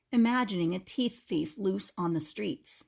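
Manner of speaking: ordinary delivery, nothing marked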